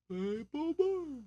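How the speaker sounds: high, soft, drawn-out cartoon bear call; Yogi Bear. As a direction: coo it slowly in falsetto. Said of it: "were called Yogu and Boo Boo".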